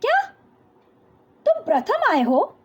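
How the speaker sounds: "क्या" lifted into an astonished rising question, "तुम" stressed; surprised question. Shown as a demonstration. Teacher demonstrating surprise at a student coming first, astonished. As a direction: ask it in disbelief, like you can't believe they came first. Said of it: "आश्चर्य सूचक के रूप में जब क्या का प्रयोग किया जाएगा".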